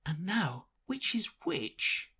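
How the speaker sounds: put-on high, wondering little girl; Alice's voice. Little Alice wondering to herself, high girlish voice. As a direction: ask it as a puzzled young girl.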